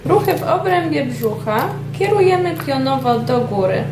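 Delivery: ordinary talk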